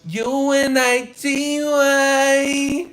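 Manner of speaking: very high, loud mock rap shout. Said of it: "turned into Queen, [name]".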